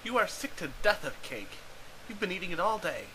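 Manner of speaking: ordinary delivery, nothing marked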